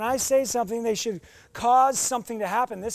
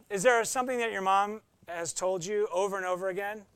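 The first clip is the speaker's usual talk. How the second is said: ordinary talk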